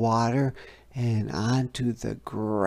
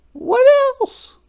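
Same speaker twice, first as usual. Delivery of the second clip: very high, louder, eager fish voice; impatient fish; 10 dB louder than usual. A narrator's reading. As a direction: demand more impatiently. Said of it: "asked the fish impatiently".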